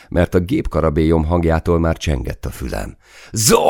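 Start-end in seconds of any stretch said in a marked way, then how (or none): 3.3-3.6 loud, high shout of a name; urgent call. A narrator's reading. Soldier rousing the silent gunner, urgent shout. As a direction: call out to jolt someone into action. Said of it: "próbáltam kiugrasztani".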